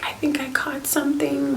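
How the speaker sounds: high, whispered imitation of a worried sister; a hint of panicked worry, whispered. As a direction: whisper it like you fear you're sick. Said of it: "She's just like".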